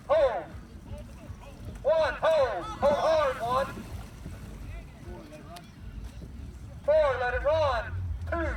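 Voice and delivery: starter over loudspeaker; race announcer. Real, not acted. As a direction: call boats to the line.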